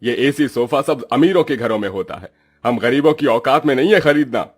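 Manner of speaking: fed-up father telling off his daughter; cross, emphatic reprimand; irritated scolding. Acted. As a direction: scold with rising exasperation. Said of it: "गरीबी से झुंझलाया सत्यकाम अपनी दूसरी बेटी भारती को डांट लगाते हुए बोला".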